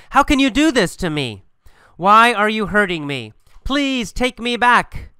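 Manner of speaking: high-pitched, wounded appeal; hurt pleading. Acted. Dumped girlfriend begs to be taken back, hurt pleading. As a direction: plead with rising upset, slowing on the last plea.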